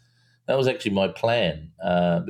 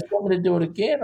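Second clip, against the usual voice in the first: put-on quick and much higher, childlike; kid's keen offer, pitched up and fast; imitating the kid; 10 semitones higher, much faster than usual. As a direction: rattle it off like an eager boy. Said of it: "he goes".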